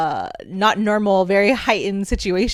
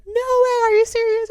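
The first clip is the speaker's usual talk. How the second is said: high, amazed disbelief; freaking out. Retold or imitated. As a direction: squeal in shock. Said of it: "freaking out".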